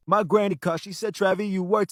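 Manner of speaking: the leveled take after processing; smooth, tamed vocal recording; clean processed vocal. Shown as a demonstration. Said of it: "to this".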